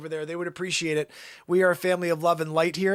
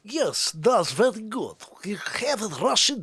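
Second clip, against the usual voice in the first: put-on Russian accent. Streamer being tested by TikTok viewers, Russian accent. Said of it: "when you do the Russian accent".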